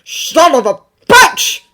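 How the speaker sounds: loud, slow angry half shout; angry shop owner half shouting at Bucky. A narrator's reading. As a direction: bite it out furiously. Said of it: "the man half shouts".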